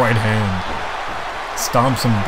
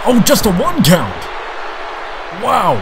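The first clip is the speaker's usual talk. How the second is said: excited shout; 8 semitones higher than usual. Real, not acted. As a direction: call the kickout with rising excitement. Kickout at one, live call.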